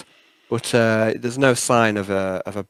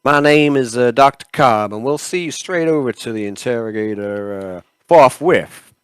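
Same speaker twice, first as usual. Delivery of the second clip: attempted Southern accent; 5 dB louder than usual. Doctor introducing himself to patient, Southern accent. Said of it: "And the doctor, he goes".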